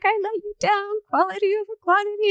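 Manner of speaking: high, rushed mock-tearful quote; almost in tears. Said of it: "almost in tears as well".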